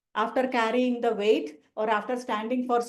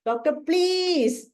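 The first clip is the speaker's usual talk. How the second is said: very slow plea pitched higher; more desperate pleading; 6 semitones higher, much slower than usual. Retold or imitated. Anxious patient begging the doctor again, higher and more desperate. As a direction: stretch it out, begging harder. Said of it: "This will be the pleading of Arsalb".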